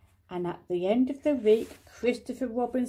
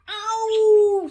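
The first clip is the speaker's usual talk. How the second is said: a sudden loud, high ow; pained yelp; 10 semitones higher, 9 dB louder than usual. Acted. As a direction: yelp as the paw is pulled. Pooh in pain being pulled.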